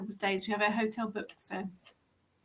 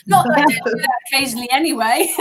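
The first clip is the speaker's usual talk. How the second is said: laughing; 7 dB louder, faster than usual. Real, not acted. Laughing at herself mid-sentence.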